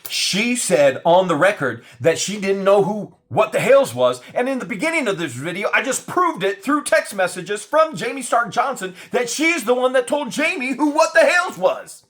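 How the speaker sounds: loud, high, heated rant; outraged rant. Real, not acted. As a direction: build the outrage to the end.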